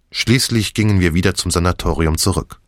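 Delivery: plain reading voice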